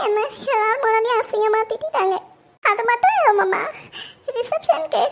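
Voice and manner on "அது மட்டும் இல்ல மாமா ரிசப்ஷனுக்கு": அது மட்டும் இல்ல said through a sob, மட்டும் pressed and falling; crying. Acted. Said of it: "ஏன் இப்படி அழுதுட்டு இருக்க". (அழுகையுடன் "அது மட்டும் இல்ல")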